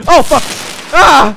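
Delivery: high, loud startled cry; startled yell. Real, not acted. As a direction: jump in fright. Sudden scare in game.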